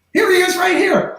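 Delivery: high excited call, shouted out. Retold or imitated. Friend calls back excitedly.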